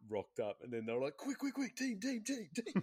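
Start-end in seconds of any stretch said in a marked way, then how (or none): 1.2-2.8 very high, frantic calls for the team; mock panic. Panicking at third party.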